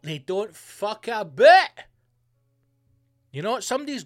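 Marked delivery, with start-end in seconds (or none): 0.0-1.8 slow and loud, each word punched; emphatic slow shout. Real, not acted. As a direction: bellow it out slowly. Ranting about Russian decisiveness.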